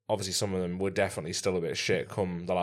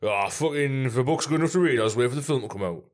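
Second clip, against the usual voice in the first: higher-pitched mock Geordie bloke; Geordie accent; 4 semitones higher, 4 dB louder than usual. Imitating pub blokes who won't read, Geordie accent. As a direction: rant as a red, bloated Geordie. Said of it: "I don't know why he's a Geordie".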